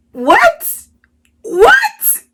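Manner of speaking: mock shock that climbs into a squeak; disbelieving what. Retelling her disbelief at date remark.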